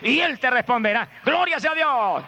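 exclamación exaltada y muy aguda; prédica a toda fuerza. Real, not acted. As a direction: exhorta a la congregación a clamar. (predicador clamando en tono agudo)

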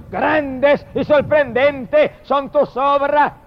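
high, slow declaimed praise; stretched-out, exalted acclamation; proclaimed hymn of praise. Acted. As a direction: proclaim it grandly, word by word. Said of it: "Y dice que cantan y dicen".

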